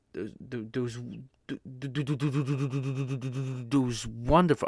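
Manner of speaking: repeated th- stuttering. Real, not acted. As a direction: get stuck on th- sounds. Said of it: "I'm, I'm stuttering".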